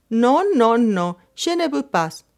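panicked pleading to be let go; hysterical. A narrator's reading. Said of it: "exclamó con voz histérica". (negativa histérica)

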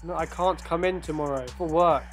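ordinary delivery

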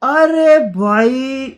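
stunned exclamation. Real, not acted. Reactor gasps at the retro beat, drawn-out astonishment. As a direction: stretch it out in awe.

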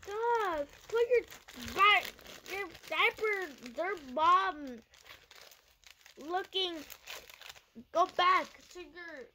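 playful outraged scolding; mock scolding. Real, not acted. Scolding the ruined popsicle.

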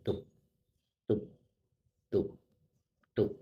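short, evenly spaced thumps with long gaps; imitated heartbeat. Describing the heartbeats seen in each person, imitated heartbeat thumps. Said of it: "หัวใจแต่ละคนเต้น".

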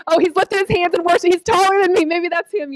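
high, eager inner voice; mock-excited younger self spotting a prospect; hopeful excitement. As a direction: pitch up high with giddy hope.

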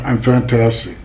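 usual speaking voice

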